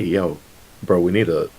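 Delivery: ordinary delivery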